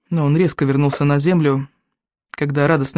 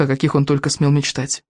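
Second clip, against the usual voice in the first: plain reading voice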